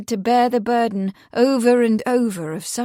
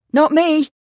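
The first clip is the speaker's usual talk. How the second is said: put-on high, loud boy's reply; excited; 7 semitones higher, 7 dB louder than usual. A narrator's reading. The boy answers the constable's question, excitedly. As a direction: deny it eagerly, pitched up.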